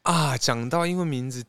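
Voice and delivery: ordinary talk, nothing marked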